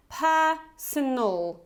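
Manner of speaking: personal, slowly, with two schwas; slow model. Shown as a demonstration. Teacher slowly models the schwas in personal. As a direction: stretch out personal to show the schwas. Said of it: "we have this schwa twice here".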